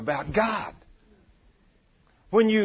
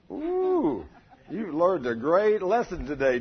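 usual speaking voice

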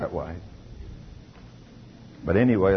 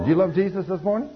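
cheery greeting; 10 semitones higher than usual. Retold or imitated. Cheerful morning greeting to workers.

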